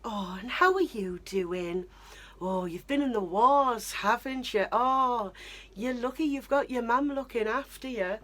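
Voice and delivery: cooing, condescending stranger; patronising stranger. Retold or imitated. Stranger bending down to a wheelchair user, lightly cooing and patronising. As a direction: talk down as to a child. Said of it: "sort of be like".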